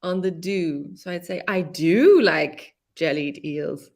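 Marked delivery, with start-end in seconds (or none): 1.5-3.9 "do" punched, "like jellied eels" lower after it; weight on "do"; stress on DO. Shown as a demonstration. Teacher repeating the example with stress on "do". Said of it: "I put that stress on the do".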